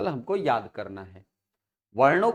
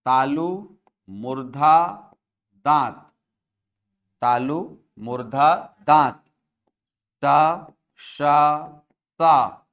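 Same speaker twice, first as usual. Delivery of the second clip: हर शब्द अलग, ज़ोर से, ठहरकर; रटने की लय में गिनाओ; rhythmic recitation; 4 dB louder, slower than usual. Shown as a demonstration. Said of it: "याद रखने के लिए". (भूल न जाएँ इसलिए लयबद्ध पाठ)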